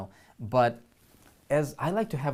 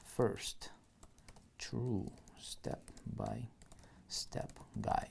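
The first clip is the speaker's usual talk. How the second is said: slow unvoiced whisper while typing; whispered dictation; 2 semitones lower, 10 dB quieter, much slower than usual. Shown as a demonstration. Coach typing copy for students, slow whisper. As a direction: whisper each word as you type it.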